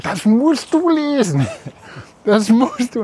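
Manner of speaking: high, urging "you must read this"; insistent friend. Retold or imitated. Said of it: "mit den Worten".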